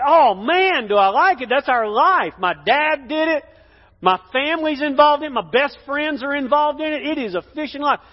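high, loud gushing about fishing; enthusiastic fisherman. Acted. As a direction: play a fisherman who loves his life. Acted fisherman keen on his work.

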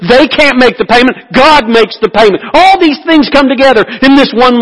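high, loud, driving sermon build-up; preaching at full force. Acted. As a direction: preach it hard and rising, pressing every line.

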